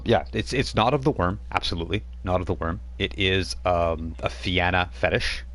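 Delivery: ordinary delivery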